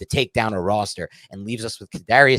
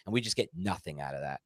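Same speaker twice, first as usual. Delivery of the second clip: ordinary talk, nothing marked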